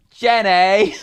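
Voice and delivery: put-on loud, high drunken Gump holler; Forrest Gump. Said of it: "start talking like Forrest Gump".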